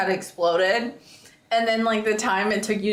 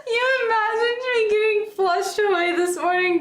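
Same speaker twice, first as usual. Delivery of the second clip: incredulous shout; 11 semitones higher than usual. Real, not acted. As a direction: cry it out in disbelief. Friend mock-outraged at the image.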